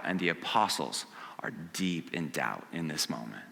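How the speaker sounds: hushed, breathy whisper of doubt; sudden stage whisper, voice gone; dramatic whisper. Acted. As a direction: drop to a whisper to pull them in. Dramatic hush over the doubting apostles.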